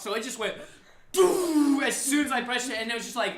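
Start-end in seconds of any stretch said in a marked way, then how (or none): 1.1-1.8 loud, high, drawn-out "boom"; explosive music drop. Retold or imitated. Storyteller imitating music as soon as he pressed play, booming shout. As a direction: mimic the bass dropping.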